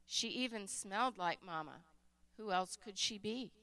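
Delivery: usual speaking voice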